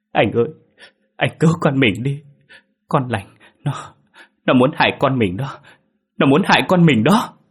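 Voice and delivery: high frightened pleading, swelling to a loud wail; tearful panic. A narrator's reading. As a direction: sob out a desperate warning. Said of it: "Cô ả lên nức nở". (khóc lóc hoảng sợ)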